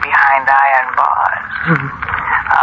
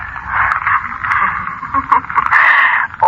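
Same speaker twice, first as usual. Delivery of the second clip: sinister laugh; 11 semitones higher than usual. Acted. Villain gloating to captive Arnie, sinister laugh.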